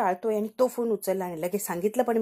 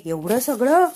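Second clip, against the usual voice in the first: shocked disbelief; 3 semitones higher, 5 dB louder, slower than usual. Retold or imitated. Shocked at video.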